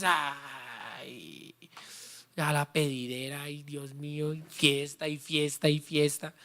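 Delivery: low, soft weary complaint, sighing "ay"; mock grumbling. Pastor mocking a believer tired of the offerings, soft and grumbling. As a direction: sigh it like a worn-out grumbler.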